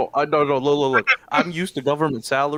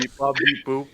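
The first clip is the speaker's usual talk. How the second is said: robot voice; 4 semitones higher than usual. Pretend. Robot character beeping.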